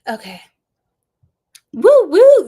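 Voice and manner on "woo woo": loud, high whooping; excited cheer. Real, not acted. Astrologer cheering Gemini season to her viewers, loud whoops. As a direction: whoop like a party is starting.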